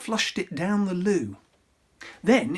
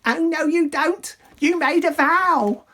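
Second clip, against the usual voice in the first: high, loud shrieking sister; higher, much louder fishwife yell; angry screech; 11 semitones higher, 7 dB louder than usual. Acted. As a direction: scold him at full pitch. Ugly sister shrieks at prince.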